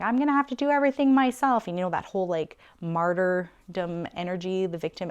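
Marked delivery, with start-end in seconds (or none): 0.0-1.7 louder, higher and fast, a retold angry yell at the kids; furious outburst. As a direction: storm through the house and snap it out. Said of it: "storming through the house being like".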